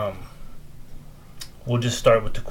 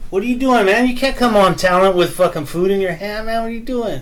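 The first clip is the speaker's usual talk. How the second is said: mock scolding; 8 semitones higher, 3 dB louder than usual. Real, not acted. Dad scolding son with food.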